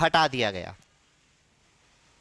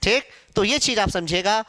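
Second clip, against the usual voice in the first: normal talking voice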